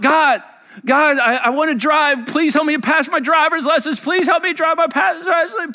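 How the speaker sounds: put-on a child begging God to drive; mock child praying.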